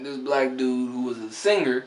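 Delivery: normal talking voice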